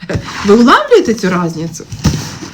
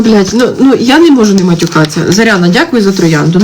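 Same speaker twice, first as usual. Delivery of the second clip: ordinary talk, nothing marked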